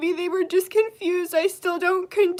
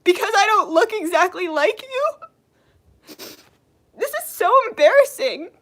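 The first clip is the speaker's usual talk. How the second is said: crying. Real, not acted. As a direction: ask it through tears. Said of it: "I'm crying".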